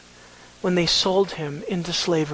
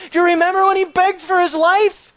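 panicked brother; 10 semitones higher, 6 dB louder than usual. Retold or imitated. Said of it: "the brothers are panicking".

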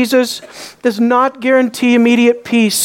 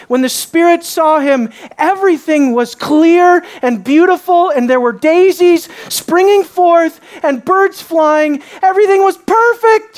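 mock rapture; 6 semitones higher than usual. Preacher mocking the false gospel of instant peace, sweet sarcastic gush. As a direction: paint the fairy-tale picture you will then tear down.

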